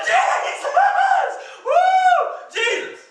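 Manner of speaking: very high, loud whoop of the name; shouting for joy. Retold or imitated. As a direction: yell it into the sky like you can't hold it in. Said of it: "run outside and shout".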